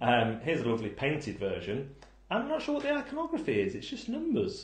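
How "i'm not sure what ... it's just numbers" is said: lightly put-on high voice for a quoted reviewer; playful mimicry of a confused commenter; mock reviewer voice. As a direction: read the comment in a high, puzzled voice. Reading a review comment aloud.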